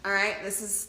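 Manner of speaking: usual speaking voice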